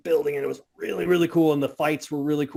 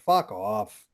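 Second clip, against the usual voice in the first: dismissive; 6 semitones lower than usual. Retold or imitated. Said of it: "I'm like".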